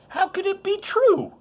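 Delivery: put-on high, breathless girlish disbelief; high voice of astonished Mary; amazed young girl. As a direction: play Mary unable to believe it.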